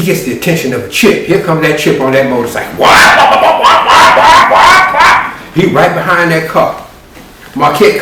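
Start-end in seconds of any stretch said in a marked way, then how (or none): 2.8-5.3 loud high wailing siren noise; siren imitation. Imitating the patrol motorcycle siren, loud wail.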